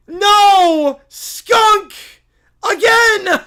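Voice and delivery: high, loud, separate exclamations; horrified shouting. Acted. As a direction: cry out in theatrical horror. Acted horror at a skunk.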